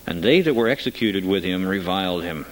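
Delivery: ordinary delivery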